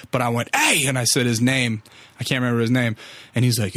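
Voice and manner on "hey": sharp high call. Retold or imitated. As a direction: shout down to the street to get attention. Calling out the window.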